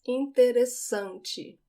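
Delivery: Interessante slow, double S as a plain s; single S sound. Shown as a demonstration. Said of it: "the double S just sounds like an S".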